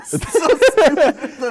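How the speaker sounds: loud laughing cheer. Real, not acted. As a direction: laugh hard while you shout.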